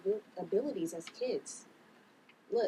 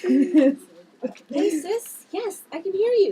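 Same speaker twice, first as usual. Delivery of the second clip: loud eager greeting; 8 semitones higher, 11 dB louder, slower than usual. Acted. Answering sister's telepathic call.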